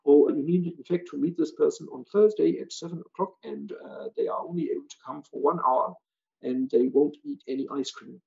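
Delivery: hammed-up German accent; playful German-accented English; silly German accent. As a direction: translate aloud in a comic German accent. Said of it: "my silly German accent".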